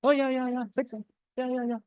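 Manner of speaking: usual speaking voice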